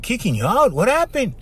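mock alarm at the news; surprised. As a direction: react with shock. Surprised reaction in retold story.

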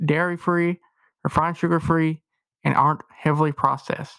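loud, upbeat sponsor read. Acted. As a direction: sell it like a radio ad. Host reads sponsor ad.

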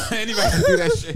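high laughing mimicry. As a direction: echo it back, laughing fast. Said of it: "Bro, me n".

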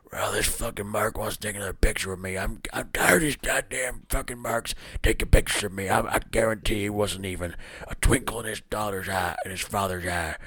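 soft, raspy whispered menace; a hushed, sneering veteran wrestler muttering to himself; Jake the Snake Roberts. Pretend. Imagining the wrestler grumbling about fans wanting pictures, soft whispered menace. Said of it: "my first attempt at a Jake the Snake Roberts impression".

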